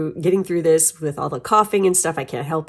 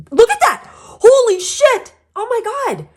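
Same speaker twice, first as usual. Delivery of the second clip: high, loud amazed squeal; shocked squeal; 10 dB louder, slower than usual. Real, not acted. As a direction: squeal in disbelief. Shocked at the card pulled.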